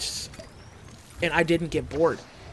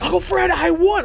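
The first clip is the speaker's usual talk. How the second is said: excited shouting; 9 dB louder than usual. Retold or imitated. Bursting in on his uncle.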